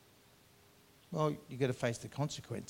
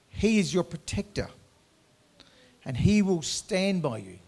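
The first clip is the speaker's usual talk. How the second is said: louder, higher proclaiming of God's care; rising preaching; 4 semitones higher, 5 dB louder than usual. Acted. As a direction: lift the congregation with each line. Preaching God's attributes at full force.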